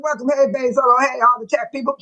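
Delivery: normal talking voice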